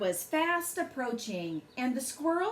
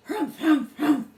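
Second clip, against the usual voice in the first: loud, higher huffing bird voice; furious bird humphing loudly; angry bird huffing; 4 semitones higher, 9 dB louder than usual. A narrator's reading. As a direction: huff it out like an indignant bird. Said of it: "yelled the booga bird".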